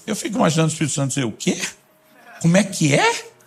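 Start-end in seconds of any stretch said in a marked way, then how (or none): 1.2-3.3 comic disbelief, leaping up on the last word; mock incredulity. Holy Spirit hearing a complaint, mock incredulous. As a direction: play a baffled double take.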